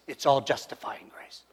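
hushed whisper. Real, not acted. Preacher whispering a warning to the congregation, hushed. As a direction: breathe it out as a warning.

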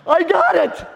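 high, loud joyful cry; excited quote. Retold or imitated. Preacher retelling friend's phone call, overjoyed high cry. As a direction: shout it with delight. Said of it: "Just like that".

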